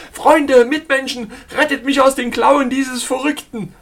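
high, loud plea for rescue; desperate appeal. A narrator's reading. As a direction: cry out to the whole carriage. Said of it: "appellierte er an die anderen Passagiere".

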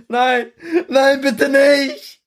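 high, loud, crying plea for life; terrified pleading cry. Acted. Frightened boy begging the soldier not to kill him, crying high. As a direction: beg for your life in tears. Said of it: "schreit er".

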